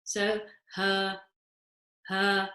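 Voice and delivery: ha low in the throat with no air; ha said low from the bottom of the throat; deep ha. Shown as a demonstration. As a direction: go deep for ha, no breath on the hand. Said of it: "with a deeper tone".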